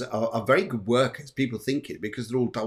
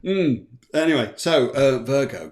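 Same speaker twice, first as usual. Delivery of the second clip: usual speaking voice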